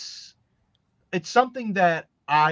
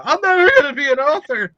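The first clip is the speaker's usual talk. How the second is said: much higher and louder hammed-up despair; mock despairing wail; 11 semitones higher, 8 dB louder, faster than usual. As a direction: ham up a writer's despairing wail. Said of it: "there's uncontrollable crying".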